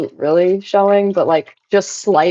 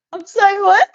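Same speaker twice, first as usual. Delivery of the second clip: much higher, incredulous; mock disbelief; 12 semitones higher than usual. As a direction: squeak out a stunned reply. Retold reaction to coworker.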